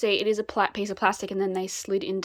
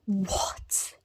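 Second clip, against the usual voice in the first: surprised whisper. Real, not acted. Surprise at unexpected detail.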